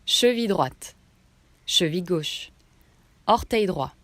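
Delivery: ordinary delivery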